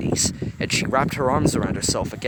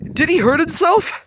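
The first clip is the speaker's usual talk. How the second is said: much higher, louder anxious question; loud, far higher fretful question; fearful; 10 dB louder than usual. A narrator's reading. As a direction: panic over his hand. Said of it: "Fear wondered aloud".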